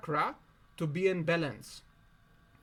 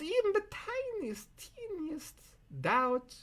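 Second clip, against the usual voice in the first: 1 tiny squeaky voice; 11 semitones higher, slower than usual. Acted. Exaggerating how tiny a doubt is.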